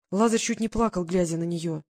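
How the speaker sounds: ordinary delivery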